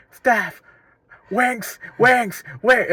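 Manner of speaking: high-pitched imitation of an assistant manager's calls; mock touchline shouting. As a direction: call out curt orders to players. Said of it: "hearing the assistant manager shouting at the players".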